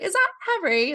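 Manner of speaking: mock British exclamation; imitating a British accent. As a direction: put on a high-pitched British voice. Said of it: "in a British accent".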